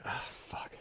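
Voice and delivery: very soft, voiceless curse; acted-out sinking dismay, near whisper; resigned dread. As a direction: sigh it out under the breath. Retold dread of telling principal.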